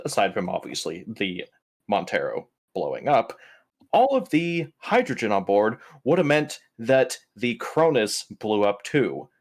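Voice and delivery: normal talking voice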